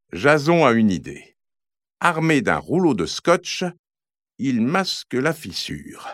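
usual speaking voice

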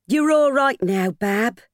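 high, comforting landlady voice; reassuring. Acted. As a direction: soothe the tired mother. Landlady comforts new mother.

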